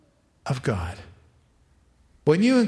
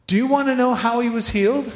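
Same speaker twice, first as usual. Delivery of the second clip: eager question; 8 semitones higher, 6 dB louder, faster than usual. Acted. Preacher rhetorical question to crowd.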